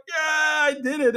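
high, loud, drawn-out victory yell; mock triumphant yeah; triumphant shout. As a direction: relive the win with a cheer. He wins the one-on-one.

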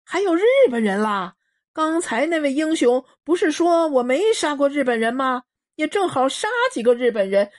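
高声戏谑地嘲弄; 满不在乎地大声取笑; 嘲弄的恶霸. Acted. 丁八子嘲弄日本战俘. (恶霸司令高声嘲弄)

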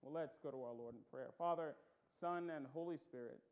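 usual speaking voice

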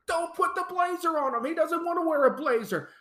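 high, rapid exasperated plea; exasperated protest. Real, not acted. Host objects to Randy wearing a blazer, exasperated protest. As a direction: protest it fast, pitched right up.

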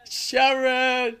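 high loud yell of Sharon; [name] yelling Sharon. Retold or imitated. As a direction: bellow the name up high. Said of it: "channeling his inner [name]".

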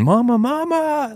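put-on very high, slow wail of a terrified toddler, without real volume; screaming child imitated. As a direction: mimic the boy's panicked calling. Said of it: "he's screaming, like, he's yelling".

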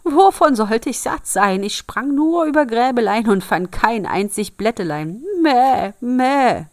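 high, loud bleating goat; loud, indignant goat voice; goat calling out. A narrator's reading. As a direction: cry out the complaint as a goat, ending in bleats. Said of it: "die Ziege ... rief".

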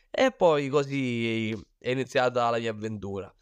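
usual speaking voice

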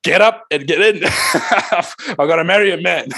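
high, laughing voice of a bride urging her bridesmaids; excited bride. Retold or imitated. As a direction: play the wife, giggling as she rushes to the aisle. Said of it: "she told the bridesmaids".

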